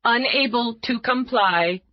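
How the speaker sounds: a cool machine reading out its rules; computer voice. Acted.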